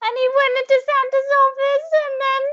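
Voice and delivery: high, breathless little elf recounting the attack; a small elf still shaken by the monster; frightened elf telling. Acted.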